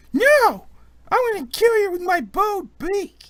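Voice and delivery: put-on very high, loud child squeal; feisty little kid. Little kid threatening the demon prince, feisty. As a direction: shriek it like a small kid play-fighting. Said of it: "but the little kid's like".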